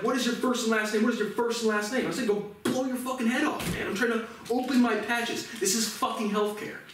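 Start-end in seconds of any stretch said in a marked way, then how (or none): 0.0-2.1 insistent demand. Retold or imitated. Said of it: "he starts asking me four or five times in a row". 2.3-6.8 high-pitched, heated comeback, retold; angry retort. Retelling the retort to the manager, angry.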